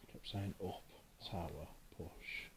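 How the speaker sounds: hushed whisper. Real, not acted. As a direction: whisper so nothing is disturbed. Whispering during a ghost hunt.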